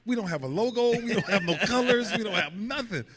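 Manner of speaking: high, laughing disbelief; incredulous. Retold or imitated. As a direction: list what the team is missing in shock. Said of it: "So I'm like".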